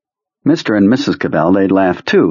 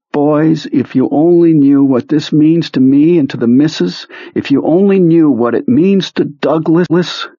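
crying; 8 semitones higher, 3 dB louder than usual. A narrator's reading. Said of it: "had started crying, saying".

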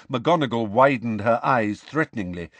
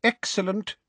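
pleased; 11 semitones higher than usual. A narrator's reading. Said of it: "a smile spreading across his face".

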